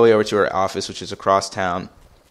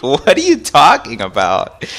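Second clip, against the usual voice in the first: higher, louder baffled question; incredulous; 5 semitones higher, 5 dB louder than usual. Retold or imitated. Son reacts to mom's wild theory, incredulous. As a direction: throw it back in disbelief.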